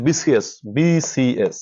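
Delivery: বিসিএস said slowly, each letter clear, starting with bi; sounding out BCS. Shown as a demonstration. Teacher sounding out an abbreviation for students. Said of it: "বাংলায় উচ্চারণ করো".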